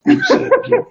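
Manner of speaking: whispery, emphatic show-off of a catchphrase; breathy come-on, played up for listeners; signature catchphrase. Shown as a demonstration. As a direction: punch out the seductive catchphrase in a loud whisper. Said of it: "I'm known by the signature phrase".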